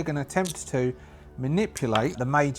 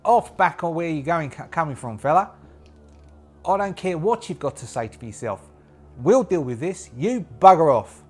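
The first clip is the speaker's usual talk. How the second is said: loud, higher, badly faked Australian; bad Australian accent; 4 semitones higher, 6 dB louder than usual. The Major sends a stranger packing, bad mock-Australian accent. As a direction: bark it in a hammy Australian accent. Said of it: "see if I can do an Australian".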